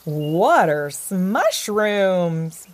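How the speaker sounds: loud, very slow, drawn-out boom; big booming entrance. A narrator's reading. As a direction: boom it out, stretching the words. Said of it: "boomed a familiar voice".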